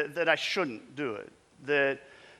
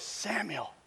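soft call; 6 semitones higher, 7 dB quieter than usual. Acted. God calling Samuel again.

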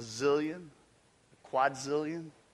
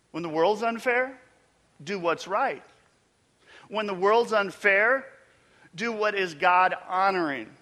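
rising, louder preaching, pressing each line harder; preaching at full force; 3 semitones higher, 6 dB louder than usual. Acted. Preacher pressing the congregation to do right, building loud. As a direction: drive the refrain home to the congregation.

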